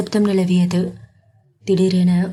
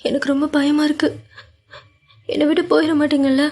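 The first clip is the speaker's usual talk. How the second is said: lightly voiced tearful girl, higher pitched; a touch of sobbing plea; understated tearful Meera; crying; 8 semitones higher, faster than usual. A narrator's reading. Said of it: "Meera அழுது கொண்டே".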